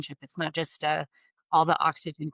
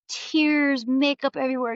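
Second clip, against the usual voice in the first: usual speaking voice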